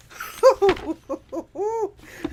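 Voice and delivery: high loud burst of laughter; laughing out loud; hearty laugh. Real, not acted. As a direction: let out a big real laugh. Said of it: "I had me a good laugh".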